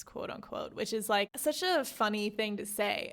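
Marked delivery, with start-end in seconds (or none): 0.0-0.7 breathy, muted quote unquote; a wry whispered aside. Real, not acted. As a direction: drop to a whisper for the air quotes. Sarcastic aside after a quoted word.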